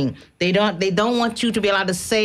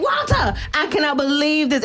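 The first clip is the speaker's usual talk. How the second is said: high, incredulous retold outcry; retold yelling; 7 semitones higher than usual. Phoning Walter in disbelief, imitated yelling. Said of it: "I called him yelling".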